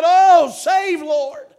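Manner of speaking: loud, high plea of "Oh, save"; crying out to God. Acted. Preacher voicing his own prayer to God, crying out loudly. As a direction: cry "Oh, save, Lord" out as a desperate prayer. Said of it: "But I'm still crying out".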